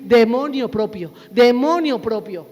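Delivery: loud, punched demonio propio; mock-misspeak "demonio" for dominio, loudly; the preacher mocking the wrong phrase "demonio propio" loudly; wrong word. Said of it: "vas a decir".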